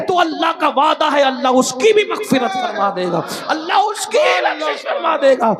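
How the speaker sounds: high, loud, soaring sermon climax; impassioned high, loud call to repent; fiery preaching. Acted. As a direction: preach it at full force to the crowd.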